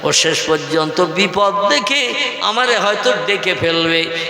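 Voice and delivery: ordinary talk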